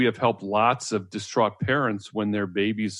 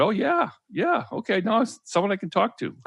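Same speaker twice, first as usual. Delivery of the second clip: put-on high-pitched, relieved baby's reaction; delighted baby voiced; 11 semitones higher than usual. Babies glad someone can talk to them, delighted. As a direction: pitch it up, as a pleased little one. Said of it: "they said".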